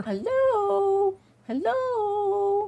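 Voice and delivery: high-pitched, drawn-out hello; calling out. Pretend. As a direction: sing out to check who is there. Calling out after video glitch.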